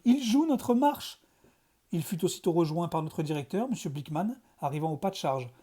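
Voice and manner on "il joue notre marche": joie aiguë et étirée lentement; ravi. A narrator's reading. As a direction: savoure chaque mot, émerveillé. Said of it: "s'écria-t-il ravi". (professeur de musique ravi, voix haute et lente)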